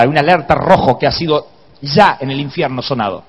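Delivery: ordinary talk, nothing marked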